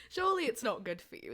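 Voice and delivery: ordinary talk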